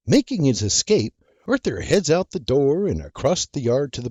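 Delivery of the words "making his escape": plain reading voice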